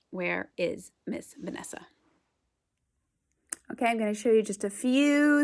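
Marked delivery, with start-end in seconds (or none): 0.1-1.9 whispering. Acted. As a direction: whisper it like a secret. Teacher playfully hiding from kids.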